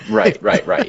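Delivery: high bursts of getting it; oh right, now I get it; sudden realization. Real, not acted. Realizing the joke.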